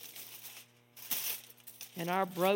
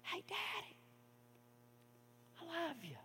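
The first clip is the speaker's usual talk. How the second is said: faint distant cry; 11 semitones higher, 17 dB quieter than usual. Retold or imitated. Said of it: "as the little boy cried".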